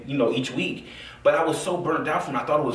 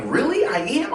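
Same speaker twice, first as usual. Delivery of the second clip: surprised; 10 semitones higher, slower than usual. Retold or imitated. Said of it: "I was like".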